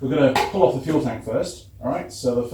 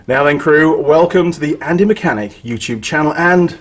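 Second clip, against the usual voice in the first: YouTube intro; 7 semitones higher, 7 dB louder than usual. Acted. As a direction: greet the crew like a channel host. Channel intro greeting.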